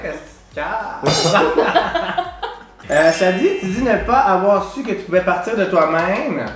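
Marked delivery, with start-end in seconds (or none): none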